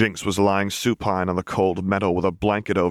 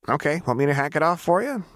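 put-on higher, fast, carefree girl; Jinx voice, breezy; 8 semitones higher, faster than usual. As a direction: toss it off casually. Jinx's casual offer.

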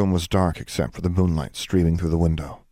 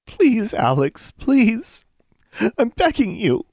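high, slow, sobbing plea; Anna breaks down begging her friend; desperate begging; 4 dB louder, slower than usual. A narrator's reading. Anna sobs her plea to Alex, high and desperate. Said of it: "dropped her wand with a sob".

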